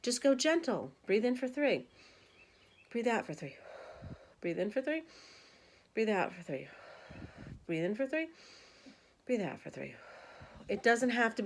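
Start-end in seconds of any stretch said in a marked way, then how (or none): 1.1-9.9 soft, paced counting of breaths; guided breathing count. Shown as a demonstration. Instructor guiding a calm breathing cycle, soft and paced. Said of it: "guided breathing count".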